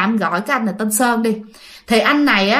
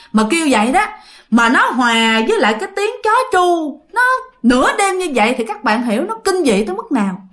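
ordinary delivery, nothing marked

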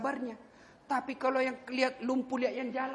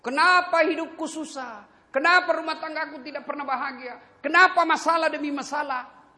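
louder lamenting questions; complaining husband; 7 semitones higher, 6 dB louder than usual. Retold or imitated. Preacher imitates a husband lamenting his troubles, loud lament.

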